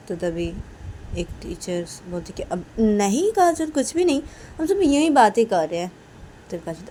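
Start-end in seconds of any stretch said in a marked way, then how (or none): 2.4-5.9 evasive teacher. Acted. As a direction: wave her question away. Teacher brushes Kajal off.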